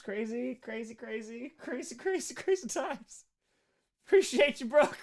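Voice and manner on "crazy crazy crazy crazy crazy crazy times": crazy repeated softer and softer, then a little higher; trailing off. Real, not acted. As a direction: let the crazies fade away.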